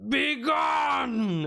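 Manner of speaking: high shrieking ghost shout; ghostly shout. Acted. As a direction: screech it at them. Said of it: "and just says".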